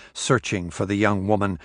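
plain reading voice

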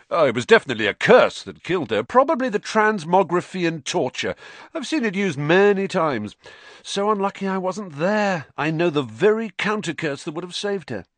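high, quick, breezy know-it-all; busybody showing off; boastful Lockhart. A narrator's reading. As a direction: prattle on self-importantly at a high pitch. Lockhart boasting about counter-curses.

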